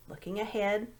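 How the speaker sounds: ordinary delivery, nothing marked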